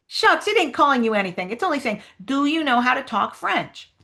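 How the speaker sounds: lightly voiced character, lifted in pitch; Huck Finn. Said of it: "read the Huck Finn lines".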